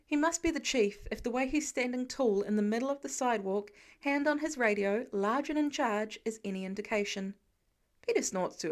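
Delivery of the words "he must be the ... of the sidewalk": usual speaking voice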